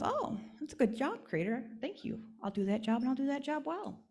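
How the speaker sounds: a cheerful doll happily promising to do her job; eager cornhusk doll. Acted.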